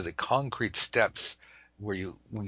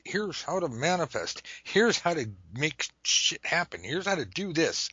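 mock hype; 7 semitones higher, 3 dB louder than usual. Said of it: "everyone that says".

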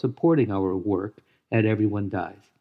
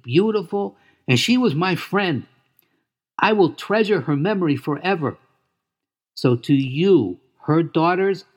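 put-on high, eager young pig voice; excited gentle pig, proud and tender; Wilbur voice; 4 semitones higher than usual. Wilbur greets Charlotte's daughters.

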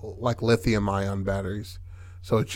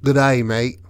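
hammed-up Aussie greeting; jokey Australian accent; 4 dB louder than usual. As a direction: play a mock Australian koala. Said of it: "Has an Australian accent".

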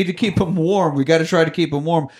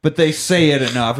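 ordinary talk

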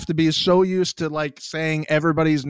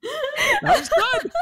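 very high, laughing excitement; delighted squeal. Real, not acted. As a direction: squeal with glee as it lands. Host delighted at guest's early answer.

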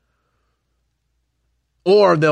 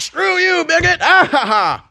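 very high taunting voice ending in mock laughter; much higher hammed-up jeer; mocking sneer; 6 dB louder than usual. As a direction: parody a jeering opponent.

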